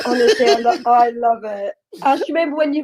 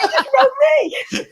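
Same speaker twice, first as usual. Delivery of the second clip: baffled; 12 semitones higher, faster than usual. Retold or imitated. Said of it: "And I was like".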